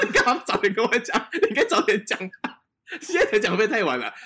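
high, loud, laughing mock scolding; half-laughing, telling a friend off for speaking up late; laughing disbelief. To a scared friend afraid of heights, laughing scolding. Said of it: "我说".

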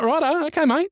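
very high, hammed-up righto; dad replying to his son, pitched high; mock agreeable dad. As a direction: play the baffled dad going along. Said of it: "So I was like".

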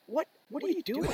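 what are you doing, squeaked high; incredulous. Retold or imitated. Friend baffled as Wayne goes to back of line, high incredulous squeak. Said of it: "And I'm like".

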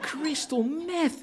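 stunned repeat with a laugh breaking through; incredulous laugh. Acted. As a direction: stretch it out, half laughing, unable to believe it. Son repeats mother's shocking words.

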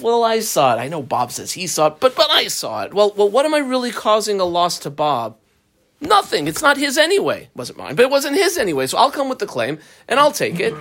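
high-pitched acted inner argument; self-justifying rationalizing. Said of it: "human beings are excellent at rationalizing".

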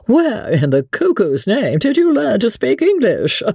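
high, astonished fop ending in a laugh; undisguised wonderment. A narrator's reading. Sir Percy quizzes the Frenchman on his English, astonished. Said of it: "with undisguised wonderment".

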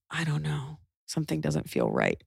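low, soft, hesitant misgiving; hushed doubt. Retold or imitated. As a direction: voice a quiet, uneasy suspicion. Retelling mother-in-law's private doubt.

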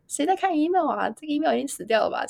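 high-pitched mock incredulity; dismissive disbelief. Recalling an old opinion of email marketing, incredulous. Voices own past dismissive thought.

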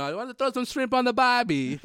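high-pitched cartoon Australian; mock Australian accent. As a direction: tease with a hammed-up Australian accent. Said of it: "Sway wanted to be like".

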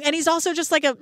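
ordinary talk

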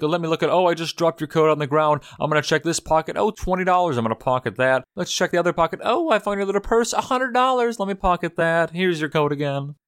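high, sing-song fake-innocent voice; mock posh lady. Retelling Lola rifling the coat pockets, in a high mock-innocent voice. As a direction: chirp it like a sly thief playing sweet.

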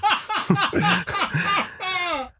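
high, breathy burst of laughing; high burst of laughter. Real, not acted. Host cracks up at his own story, high burst of laughter. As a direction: crack up at the punchline.

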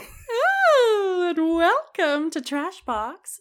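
high, slow, drawn-out welcome, like a campy host; hammed-up show intro. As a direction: stretch the welcome out in a sing-song host voice. Podcast intro, hamming up welcome.

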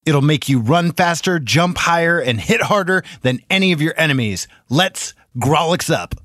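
punchy promo voice, energetic; announcer read. Acted. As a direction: announce it like a trailer. Show intro read.

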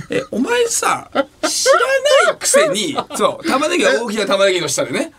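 高い声で笑い混じりに突っ込む; 相方の失言を笑いながら問い詰める; 笑いながら責める. Real, not acted. 相方を笑いながら責める. (笑いながら相方を責め立てる)

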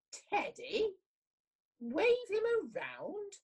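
high, outraged little tiger; indignant squeak, slow and offended; hopping mad. A narrator's reading. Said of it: "Chester was hopping mad".

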